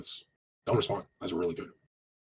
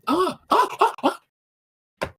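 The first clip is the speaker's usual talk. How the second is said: mock moans; 8 dB louder, much slower than usual.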